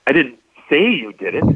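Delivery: "say" lifted and held, rising at the end; stress on SAY. Shown as a demonstration. Showing six meanings of one sentence, stress on "say". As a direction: bring out "say" so pointing or writing it stays possible. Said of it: "maybe I pointed at you".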